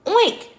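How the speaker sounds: high, loud oink; pig oink. A narrator's reading. Pig cold and wet, loud oink.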